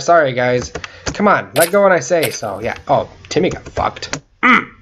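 usual speaking voice

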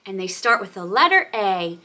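normal talking voice

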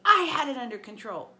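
loud indignant shout; angry shout. Acted. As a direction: insist hotly she didn't need help. Clarice continues yelling.

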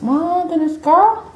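high, playful swoon; hammed-up gushing. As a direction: squeal it in mock amazement. Repeats it gushing over pizza.

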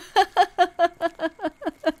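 high, loud playful giggle; teasing laugh. Acted. Girlfriend laughing as she teases him about the cute owner. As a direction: laugh at him for being caught out.

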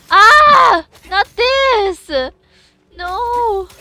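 high, loud desperate cries; panicked screams. Real, not acted. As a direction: scream in real panic as everything goes wrong. Panicking as the game fight goes bad.

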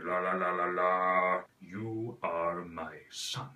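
low, slow throaty rumble; deep villain warming up the voice; Darth Vader voice. Shown as a demonstration. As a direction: drag it from the back of the throat. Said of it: "lower like this".

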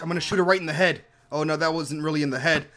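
usual speaking voice

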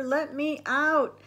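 storybook cry of panic; panicked scream. A narrator's reading. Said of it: "screamed Buster".

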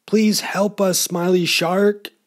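high-pitched storybook plea for rescue; acted appeal from trapped fish; pleading cry. A narrator's reading. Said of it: "cried the fish".

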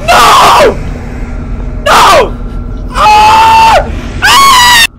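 very high, loud shriek; real fright, loud and high; terrified scream. Real, not acted. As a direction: scream as the thing appears. Final jumpscare.

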